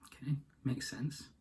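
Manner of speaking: soft whispered aside to the listener. Real, not acted. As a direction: drop to a whisper, half to yourself. Aside after the wolf's reply.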